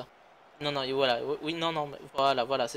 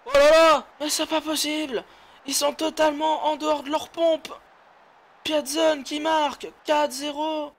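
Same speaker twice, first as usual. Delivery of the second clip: excited commentator; 9 semitones higher than usual. Real, not acted. Goal frenzy commentary.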